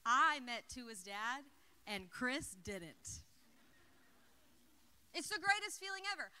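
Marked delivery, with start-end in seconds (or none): 0.1-3.2 slow, louder, savoring each word; proud boast. Shown as a demonstration. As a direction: gloat it out, drawn out with pride. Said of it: "gives me so much pride to be able to say".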